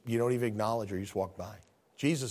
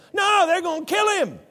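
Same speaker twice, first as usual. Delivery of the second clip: loud, high emphatic shout; shouted correction to the congregation; 8 dB louder than usual. Real, not acted. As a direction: hammer the point home. Preacher insists, shouting.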